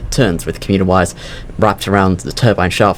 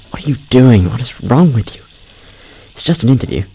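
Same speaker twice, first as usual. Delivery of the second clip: exasperated self-reproach, a little raised; scolding oneself; 3 semitones higher than usual. Acted. Nervous applicant alone in the restroom, scolding self. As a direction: berate yourself under pressure.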